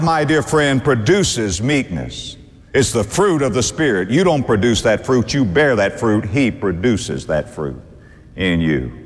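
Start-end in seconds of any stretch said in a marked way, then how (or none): none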